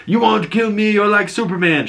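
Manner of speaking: a squeaky mock question tossed at her; mocking interviewer. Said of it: "and he was like".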